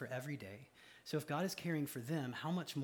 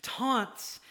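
high, loud, pleading cry to the Lord; anguished plea to God; 11 semitones higher, 11 dB louder than usual. Retold or imitated. Preacher voicing a struggling believer, loud desperate plea. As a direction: voice a worn-down believer begging God. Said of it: "You're like".

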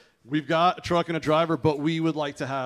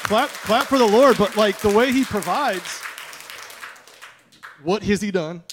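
loud, high call to the congregation; rallying applause; 4 semitones higher, 9 dB louder than usual. Real, not acted. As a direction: call out over the room to get them clapping.

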